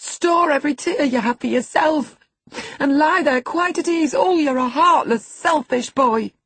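high, loud, mounting indignation; impassioned scolding. A narrator's reading. Housekeeper berates the selfish boy for lacking pity, outraged. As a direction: shame him, building to an outraged peak.

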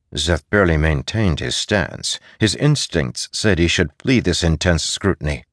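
plain reading voice

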